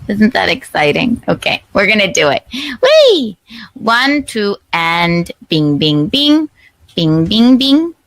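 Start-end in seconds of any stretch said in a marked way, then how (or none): 2.5-3.4 high gleeful whee; excited squeal. Real, not acted. 5.5-7.9 sing-song bing. Pretend. Sound-effect chant bing.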